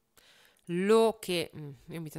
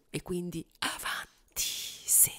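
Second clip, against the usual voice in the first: under the breath, whispering; whispered aside; slower than usual. Real, not acted. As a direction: whisper it to yourself. Quiet aside while working.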